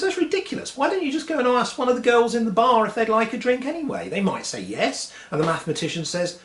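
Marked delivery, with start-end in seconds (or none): 0.0-5.1 much higher, incredulous bartender. Acted. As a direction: scoff at him in a high voice. Bartender mocks mathematician.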